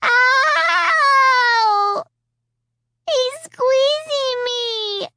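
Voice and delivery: loud high shouted cry, then slow high wail; a small girl screaming in pain; child's pained cry. A narrator's reading. Little Alicia crying out as the hand squeezes.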